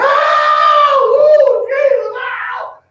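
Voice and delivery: very high, loud shouted cry of rapture; ecstatic church holler. Retold or imitated. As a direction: holler like a woman caught up in the spirit in church. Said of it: "she coming in that pulpit and hollering".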